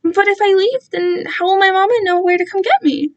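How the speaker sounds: put-on brainwashed boy, sincerely confused; innocent child voice. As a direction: ask it like a trusting small child.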